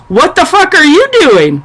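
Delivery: high, throwing the question right back; high mock retort; mock defiant wife. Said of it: "And she goes".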